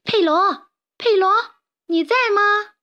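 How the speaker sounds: very high, loud call across the yard. Acted. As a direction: shout his name to reach him far off. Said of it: "卡门冲到屋外大喊".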